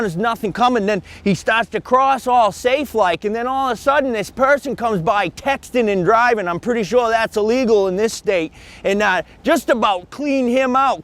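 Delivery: breathless bystander telling the news; eyewitness voice. Acted. Witness interviewed on location.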